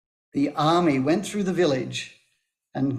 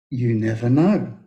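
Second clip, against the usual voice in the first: low, even master's voice; calm master; 6 semitones lower than usual. A narrator's reading. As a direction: drop down into the unbothered sage. Parable, master's calm reply.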